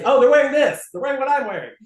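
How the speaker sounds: loud, high pretend alarm; mock outcry. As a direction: exclaim it as a hammed-up complaint. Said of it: "you gotta say".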